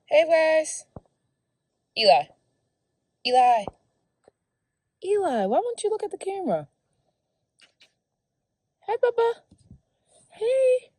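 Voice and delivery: high, loud cooing call. Real, not acted. Mother calling her son to look at the camera.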